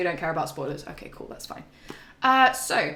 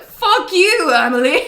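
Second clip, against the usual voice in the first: much higher, loud jokey yell with a laugh; mock outburst; 9 semitones higher, 10 dB louder than usual. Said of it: "And I was like".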